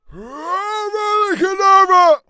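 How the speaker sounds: high, loud villain cackle; hammed-up high evil laugh. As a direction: cackle like the Dark Lord. Voldemort laugh attempt.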